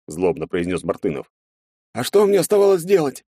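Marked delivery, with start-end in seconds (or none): 1.9-3.2 much higher, timid soldier's voice; a scared young sergeant answering; frightened soldier. A narrator's reading. (испуганный солдат, высоким голосом)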